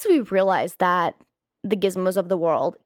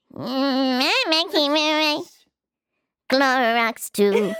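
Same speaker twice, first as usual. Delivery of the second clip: high, slow creature croak; gremlin voice; 4 semitones higher, slower than usual. Retold or imitated. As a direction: drag it out like a little gremlin. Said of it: "his gremlin voice".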